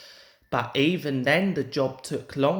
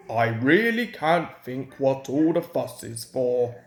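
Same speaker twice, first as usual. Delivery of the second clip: lower, put-on innocent engine voice; Duncan, innocently; 5 semitones lower than usual. A narrator's reading. Duncan teasing Peter Sam, mock innocent and lower. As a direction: play dumb in a lower voice. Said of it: "remarked Duncan innocently".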